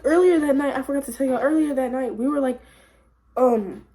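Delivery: ordinary talk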